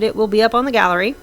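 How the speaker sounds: normal talking voice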